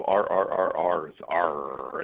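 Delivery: growly pirate "arr". Pretend. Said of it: "in my best pirate voice".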